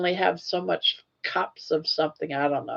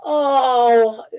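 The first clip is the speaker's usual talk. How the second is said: mock crowd groan; 6 semitones higher, 6 dB louder than usual. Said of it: "And everyone goes".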